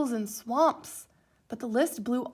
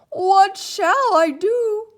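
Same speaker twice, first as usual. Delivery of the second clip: high, loud, slow despairing cry; cried out; 9 semitones higher, 14 dB louder, much slower than usual. A narrator's reading. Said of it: "cried Toad".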